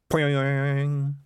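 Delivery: long, drawn-out boing; stretched-out cartoon spring noise; imitated spring twang. A narrator's reading.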